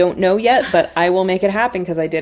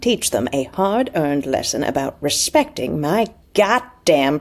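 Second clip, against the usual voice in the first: Southern accent. Shown as a demonstration. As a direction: play a Southern character, stretching the key words. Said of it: "Let's do Southern".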